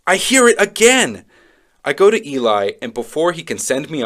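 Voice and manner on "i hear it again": high, loud outburst; exasperated exclamation. Acted. Child hears the voice yet again, high loud exclamation.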